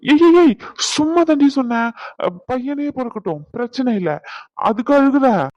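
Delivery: alarmed backpedal. Acted. Husband backpedals, wife crying.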